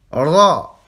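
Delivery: Ra with a rolled, trilled R. Shown as a demonstration. Teacher modelling Ra with a rolled R. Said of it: "Vous roulez le R".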